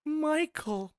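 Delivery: high, slow, halting pleading voice; actor's impression. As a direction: plead slowly like a film actor in a hammed-up impression. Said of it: "wanna see an actor go".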